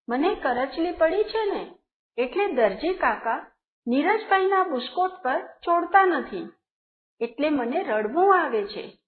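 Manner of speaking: plain reading voice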